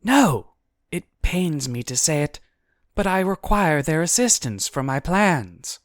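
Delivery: high, loud, emphatic refusal; forceful storybook heroine; Guinevere. A narrator's reading. Voices Guinevere refusing demon.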